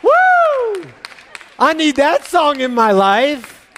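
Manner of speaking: high, loud, drawn-out joy; hyped-up excitement. Retold or imitated. Preacher retelling his joy at finding the song. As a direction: whoop it up.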